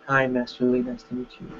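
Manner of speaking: small, timid soft introduction; shy, scared greeting in a soft voice; weak, passive, scared. Shown as a demonstration. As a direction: say it meekly, fading away as you go. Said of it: "Weak, passive, scared".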